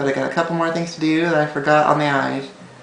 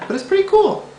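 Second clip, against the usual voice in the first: much higher, voice jumping up; voice cracking. Real, not acted. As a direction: let the voice crack upward. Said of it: "Oh, my voice went up there".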